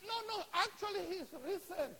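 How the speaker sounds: high-pitched excited correction; acted urgent assurance; eager insistence. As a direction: insist on the amazing news. Acts disciples insisting to Thomas.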